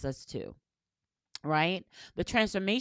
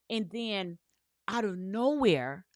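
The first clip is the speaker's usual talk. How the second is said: usual speaking voice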